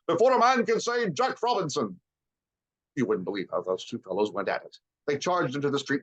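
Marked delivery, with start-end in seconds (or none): 0.1-2.0 high, merry cries; a cheerful master calling a holiday; jovial Fezziwig. A narrator's reading. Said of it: "cried old Fezziwig".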